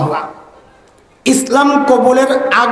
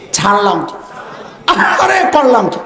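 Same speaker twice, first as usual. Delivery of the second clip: anguished regret. Shown as a demonstration. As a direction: wail as if the heart were burning. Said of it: "আহারে এমন ভাবে বলতে হবে যেন কলিজা পোড়া গন্ধ আসে".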